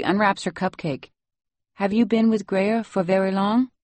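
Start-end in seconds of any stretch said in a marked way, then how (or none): 1.7-3.7 put-on higher voice with a Salvadoran accent. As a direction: ask gently in Salvadoran-accented English. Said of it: "You have such a nice accent".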